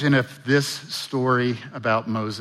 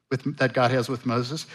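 ordinary talk, nothing marked

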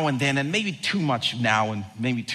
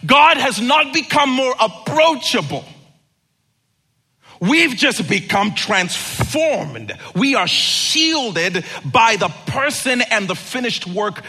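loud, higher, slow preaching; full force preaching; 6 semitones higher, 5 dB louder, slower than usual. Real, not acted. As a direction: preach it home.

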